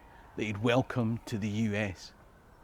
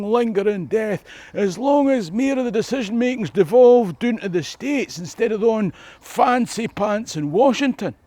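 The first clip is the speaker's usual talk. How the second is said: higher, louder mocking put-on soldier voice; sarcastic caricature, much higher and louder than the narration; Robert Smith's imagined thoughts; 8 semitones higher, 8 dB louder than usual. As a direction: lampoon a dying soldier's imagined musings, pitched up and loud. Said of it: "what did he think about?".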